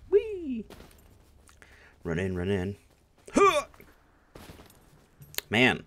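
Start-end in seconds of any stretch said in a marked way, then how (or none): 0.1-0.6 high joyful whee; gleeful whee. Pretend. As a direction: squeal it like a kid on a slide. Flying in game.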